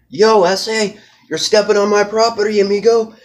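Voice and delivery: high, loud mock gangster call-out; tough guy challenge. Rival calls out the trespasser, mock tough. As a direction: bark it like a cocky street tough. Acted street confrontation quote.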